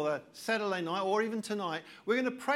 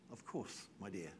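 tender father; 10 semitones lower, 15 dB quieter than usual. Retold or imitated. Father answers child tenderly.

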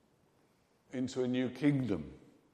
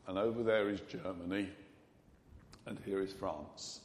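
ordinary delivery

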